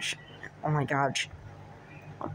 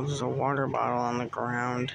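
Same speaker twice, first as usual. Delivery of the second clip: ordinary delivery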